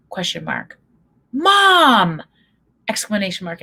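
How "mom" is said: high, loud "Mom!" in disbelief; son's high, loud mock yell; mock horrified yell. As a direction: shout it like a mortified son. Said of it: "her son texts back".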